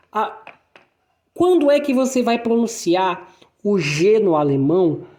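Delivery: ordinary talk, nothing marked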